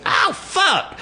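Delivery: high hammed-up yelp; very high yelp of a guy slapped across the face; mock yelp of pain. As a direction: squeal like you just got slapped.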